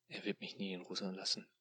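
ordinary delivery, nothing marked

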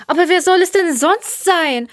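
high-pitched challenging question; exasperated protest. Acted. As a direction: argue back in exasperation. Jelena argues with the sceptics.

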